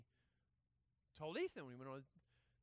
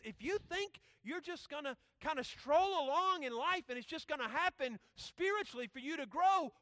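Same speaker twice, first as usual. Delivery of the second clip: loud, higher, driving sermon delivery; emphatic preaching; 9 semitones higher, 9 dB louder than usual. Acted. Preacher challenging students on spiritual growth, emphatic preaching. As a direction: preach it up, pressing the point.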